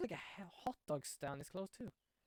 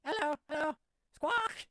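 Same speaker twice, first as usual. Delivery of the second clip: high squawky parrot greeting; 9 semitones higher, 7 dB louder, slower than usual. Acted. Parrot greeting the visitors, high squawking parrot voice. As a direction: chirp it out like a talking parrot, then squawk. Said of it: "Hey, it's Polly".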